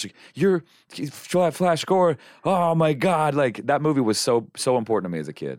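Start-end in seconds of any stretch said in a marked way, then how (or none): 0.3-3.4 high, slow starstruck stammer; fanboy reaction. Retold or imitated. Fan meeting his childhood hero, retold gushing. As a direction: stumble over the name in awe. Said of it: "fanboy re-, reaction".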